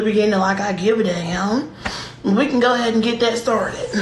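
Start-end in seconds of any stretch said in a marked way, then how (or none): none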